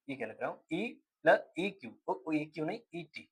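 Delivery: very soft, half-voiced self-correction; muttering to himself. Real, not acted. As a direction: mumble under your breath while fixing your own slip. Catches own writing slip. (खुद से धीमे बुदबुदाते हुए)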